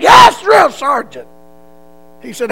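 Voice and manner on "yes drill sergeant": loud, high, slow shout; a recruit shouting back at his drill sergeant. Retold or imitated. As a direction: sound off like a scared recruit.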